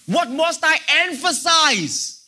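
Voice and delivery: preaching climax. Acted.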